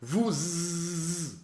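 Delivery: ordinary delivery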